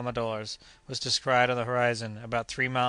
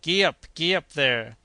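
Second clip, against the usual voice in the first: louder, higher driver's shout; gleeful shout; 4 semitones higher, 6 dB louder than usual. Acted. Joe driving the elephant, loud gleeful shout. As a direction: yell it like a delighted carter. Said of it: "shouted Joe in a high glee".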